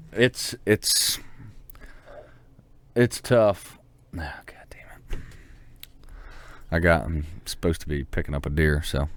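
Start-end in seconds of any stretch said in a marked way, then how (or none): 4.2-5.2 breathy whispered swear under the breath; whispered aside; whispered curse. Real, not acted. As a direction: hiss it to yourself. Muttered aside, unvoiced.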